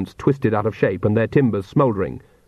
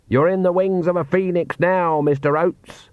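raised pitch, restrained shout; shouting over noise; 7 semitones higher than usual. A narrator's reading. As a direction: call it out above the roaring flames. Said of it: "she shouted above the noise".

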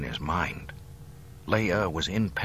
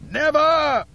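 very high, loud, enraged scream; 10 dB louder than usual. A narrator's reading. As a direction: scream it at breaking point. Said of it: "he screamed".

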